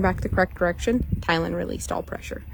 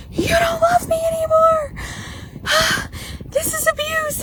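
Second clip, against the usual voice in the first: hammed-up sobbing protest of a spoiled boy; whiny crying teen. A spoiled teen wailing at mom over chores, hammed-up whine. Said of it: "he's like".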